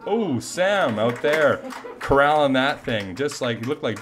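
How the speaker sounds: loud, drawn-out play-by-play call; excited call. Real, not acted. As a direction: call the catch with rising excitement. Play-by-play on a catch.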